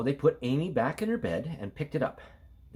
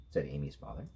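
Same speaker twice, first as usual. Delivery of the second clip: ordinary delivery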